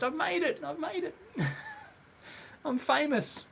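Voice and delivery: high, laughing mock celebration; mock triumph. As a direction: crow it jokingly through a laugh. Jokes about being famous.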